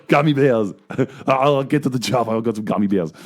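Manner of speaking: laughing Austrian action-hero impression; Arnold Schwarzenegger accent. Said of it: "in an Arnold Schwarzenegger accent".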